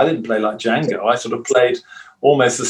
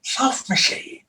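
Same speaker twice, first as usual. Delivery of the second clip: slow, high breathy announcer whisper; Spike Milligan; 9 semitones higher, 7 dB quieter, much slower than usual. Retold or imitated. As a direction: breathe the name out slowly, like a mock-grand intro. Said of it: "who went".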